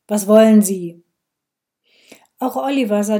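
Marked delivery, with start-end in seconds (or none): 0.1-1.0 loud, curt challenge, stress on "wollen"; a policeman turning brusquely on an intruding woman, loudly; brusque demand. A narrator's reading. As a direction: snap the question at her.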